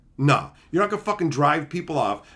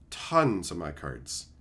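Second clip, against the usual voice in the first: much lower, slow, soft echo; low slow repeat; 12 semitones lower, 7 dB quieter, much slower than usual. Real, not acted. Repeating how many cards friends stole, low and slow. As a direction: drop it low and slow for weight. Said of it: "stealing tons of my cards".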